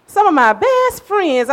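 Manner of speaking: high, drawn-out "best", like the granddaughter; imitating a granddaughter's pet phrase; granddaughter's catchphrase. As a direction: stretch it out in a playful high lilt. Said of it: "she loves to say".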